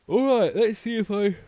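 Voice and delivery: words stretched by a big yawn. Real, not acted. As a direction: talk through a yawn. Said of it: "sorry for yawning".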